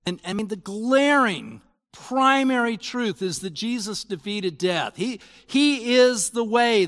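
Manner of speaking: high, loud, fervent sermon voice; impassioned pulpit delivery; full-force preaching. Acted. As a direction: preach it out with rising conviction.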